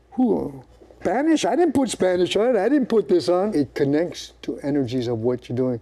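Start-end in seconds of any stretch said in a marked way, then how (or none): 0.1-3.5 mock surprise. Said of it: "you say".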